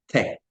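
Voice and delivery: "T" said with the tongue against the teeth; "T" made at the teeth; dental T. Shown as a demonstration. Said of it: "la otra que se pronuncia con los dientes".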